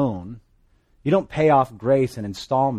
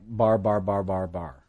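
mock barbarian babble; 5 semitones lower, slower than usual. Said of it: "all their language sounded like".

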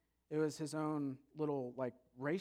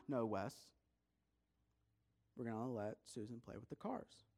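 low, soft parental firmness; calm insistence; 5 semitones lower, 5 dB quieter than usual. Acted. Mom insisting Susan gets to play, calm insistence. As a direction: stay quiet and low while holding firm.

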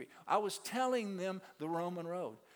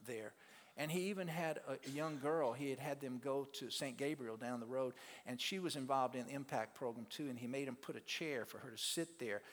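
ordinary talk

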